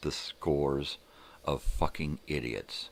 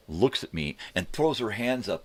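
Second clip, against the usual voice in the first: ordinary talk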